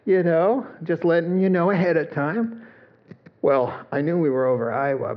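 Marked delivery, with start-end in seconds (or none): 0.0-2.6 relaxed, higher captain on the intercom; breezy pilot, playing down turbulence; light, offhand airline captain voice; casual pilot announcement. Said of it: "And he said".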